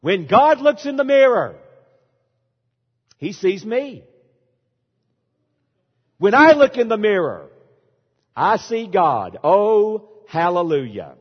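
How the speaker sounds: imitating a preacher's loud hallelujah; mock exultant preacher. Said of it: "Listen to what took place".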